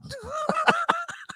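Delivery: high, helpless wheezy laugh; real high giggling fit. Real, not acted. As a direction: crack up at the prank texts. Cracking up over friends' texts.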